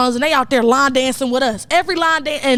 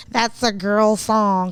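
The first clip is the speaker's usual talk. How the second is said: slow, lower put-on dude voice, dismissive; mocking insecure dude; 4 semitones lower, much slower than usual. Mocking guys who call it a girl song, slow put-on voice. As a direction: drag it out like a guy too cool for the song. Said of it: "some dudes would have been like".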